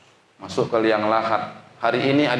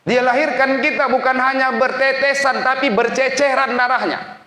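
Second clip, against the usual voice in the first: high, loud fervent sermon voice; a preacher pleading with young students; impassioned preaching; 4 dB louder than usual. Acted. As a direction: preach it out with full emotional force. Preacher rises to full force.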